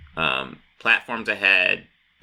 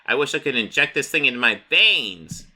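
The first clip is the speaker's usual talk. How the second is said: manic Joker; 6 semitones higher than usual. Retold or imitated. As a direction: savour it like a happy madman. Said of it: "And he's like".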